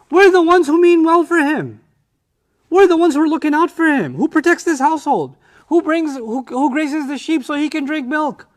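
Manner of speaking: high, loud, offended protest; indignant protest. Acted. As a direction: rail at Dad as the wronged sons. Brothers protest indignantly to father.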